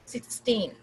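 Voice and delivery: put-on sixteen with long falling TEEN; sixteen, weight on second syllable; stress on TEEN. Shown as a demonstration. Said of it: "टीन पे".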